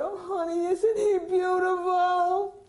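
high, loud, slow gushing wife voice with a teary crack; hammed-up wife fawning over the baby in a high voice. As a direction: coo over the newborn like a tearful new mother. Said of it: "she looked at me and she said".

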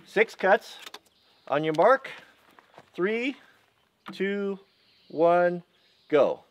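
called out high over the saw noise; race countdown. Real, not acted. Starting a timed chainsaw race.

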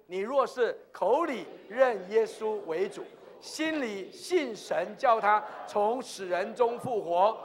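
slow, measured recitation; leading scripture reading. Real, not acted. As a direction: read it out slowly for all to follow. Leads congregation reading scripture. (缓慢有力地领读经文)

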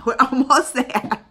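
laughing. Real, not acted. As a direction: chuckle it out hopefully. Laughs about subscriber count.

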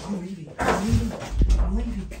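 repeated "I'm leaving", building into a shout; yelled exit. Acted. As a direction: storm out shouting. Guest storms off yelling.

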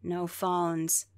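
calm, unbothered ticket monster; understated monster clerk; deadpan monster greeter. Acted. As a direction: refuse politely, unmoved. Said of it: "That should be his accent".

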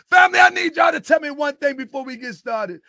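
high, loud rallying shout; fired-up loud address; yelling. Real, not acted. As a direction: yell it out to the whole family. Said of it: "am I yelling too much?".

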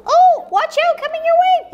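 high, loud playful warning; high toy voice. Pretend. The walrus toy warning the viewer, high loud call. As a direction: squeal it like a tiny toy charging in.

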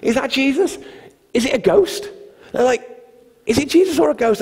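high, alarmed questions, acted in retelling; fearful disciples. Frightened disciples in the boat at a figure on the water, high and alarmed. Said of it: "they're all fearful".

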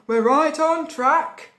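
lightly voiced crook, loud and slow; emphatic storybook villain; crook Hugh. A narrator's reading. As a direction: announce it as a pleased thief. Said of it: "said Hugh".

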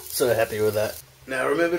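usual speaking voice

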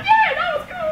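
put-on very high, loud excited baby voice; high giggling toddler voice. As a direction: squeal it like a little baby.

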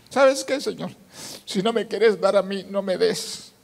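high, earnest prayer re-enacted; pleading bargain. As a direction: bargain urgently with God.

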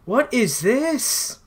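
high, slow, drawn-out disbelief; incredulous. Real, not acted. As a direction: stretch it out in disbelief. Sees another sniper.

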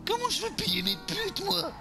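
high-pitched indignant whining; squealed mock despair; comic outrage. Outraged at having no money.

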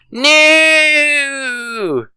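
very high, anguished cry. Acted. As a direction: cry out in despair. Banished with zero stars.

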